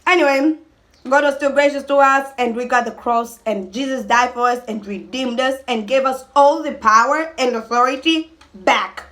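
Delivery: ordinary talk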